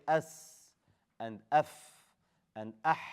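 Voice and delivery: s, f and ah each drawn out; dragged consonants. Shown as a demonstration. Teacher compares dragged letters, sustaining s, f and ah. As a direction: let the breath run on through s, f and ah. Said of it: "Needs the drag".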